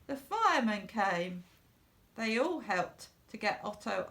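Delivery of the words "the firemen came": ordinary delivery, nothing marked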